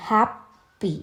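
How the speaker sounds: ഹാപ്പി in two separate beats, "hap, py"; split into syllables. Shown as a demonstration. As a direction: sound out both halves of ഹാപ്പി. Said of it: "രണ്ട് syllable ആണ്". (ഹാപ്പി രണ്ടായി മുറിച്ച്, "ഹാപ്പ്, പി")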